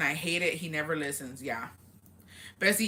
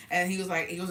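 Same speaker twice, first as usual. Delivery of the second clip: normal talking voice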